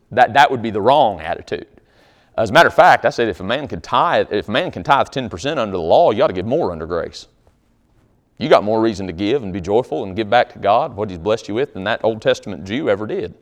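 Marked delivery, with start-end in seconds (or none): none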